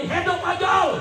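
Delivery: high-pitched rally cry; shouted crowd-leading call; slogan shout. Real, not acted.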